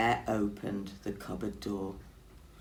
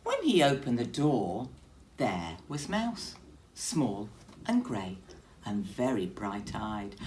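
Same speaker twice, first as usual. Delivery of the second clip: plain reading voice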